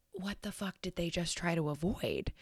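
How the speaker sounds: whispered puzzled question; hushed retold inner thought. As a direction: say it under your breath, baffled. Retelling puzzled driving thought.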